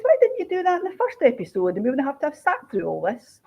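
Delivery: high, indignant rant at the telly; exasperated outburst. Retold or imitated. Author ranting at a TV drama's ending, exasperated. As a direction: throw the words at the screen in disgust.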